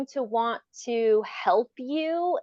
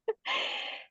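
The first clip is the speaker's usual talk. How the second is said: high burst of embarrassed laughter; 7 dB quieter than usual. Real, not acted. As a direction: laugh it off after losing the thought. Laughs after forgetting thought.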